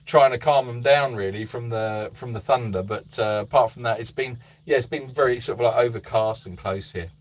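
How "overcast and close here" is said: usual speaking voice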